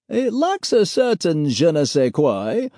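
drawn-out wry aside; Pete's voice. Acted. Pete agrees.

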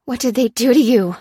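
high, loud shocked question; aghast. A narrator's reading. Lucy asks the injured prisoner what was done, aghast. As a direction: raise pitch and volume in dismay.

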